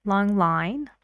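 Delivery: "Long line" with a friendly rise on "line"; rising on line. Shown as a demonstration. Teacher showing students the friendly rise, rising tone. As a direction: let "line" go up at the end.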